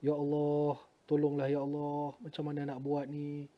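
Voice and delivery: soft, drawn-out plea for help; helpless prayer, only lightly tearful; retold tearful prayer. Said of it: "Meleleh berderai mata ni". (rintihan doa yang perlahan)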